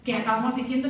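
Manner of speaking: ordinary talk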